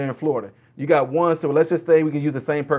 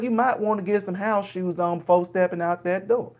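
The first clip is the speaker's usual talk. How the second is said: higher-pitched rambling Southern storyteller; slow-to-the-point Southern dialect; 4 semitones higher than usual. Acted. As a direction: ramble sociably in a Southern accent, in no hurry. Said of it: "the other dialect of Southern people down there".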